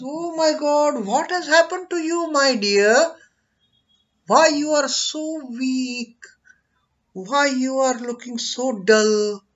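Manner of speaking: high, loud, alarmed guru's voice; worried guru. Acted. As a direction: cry out in alarm over a weak disciple. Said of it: "And guru says".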